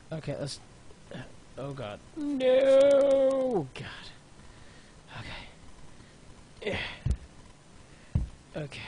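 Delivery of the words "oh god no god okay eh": usual speaking voice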